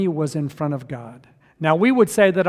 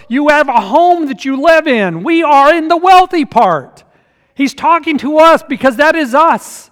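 loud, high, driving sermon delivery; preaching at full force; 7 semitones higher, 9 dB louder than usual. Real, not acted. Pastor driving the point home to the congregation, preaching loud.

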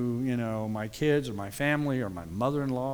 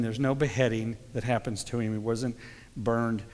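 ordinary talk, nothing marked

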